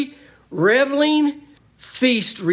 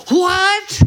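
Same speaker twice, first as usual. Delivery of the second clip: What thrown out high and loud in disbelief; a preacher's loud, incredulous cry; shouted What; 11 semitones higher, 6 dB louder than usual. Shown as a demonstration. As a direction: bark it like an outraged objection. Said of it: "He said it this way".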